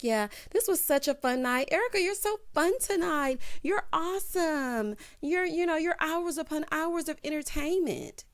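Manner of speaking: high, syrupy mock praise; fake gushing. The ladies flattering Erica, mock gushing and high. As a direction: gush like a phony fan. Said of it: "they're all like".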